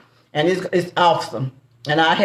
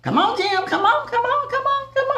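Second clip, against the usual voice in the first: high, laughing come on; playful urging; 3 dB quieter than usual. Real, not acted. Teasing Jim to hurry, in a high giggling voice. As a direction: coax him along, giggling high.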